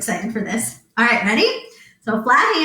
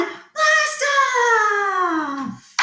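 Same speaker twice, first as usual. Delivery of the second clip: high squeal of launch; 10 semitones higher than usual. Acted. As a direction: blast shot up very high then drops. Countdown ends in launch.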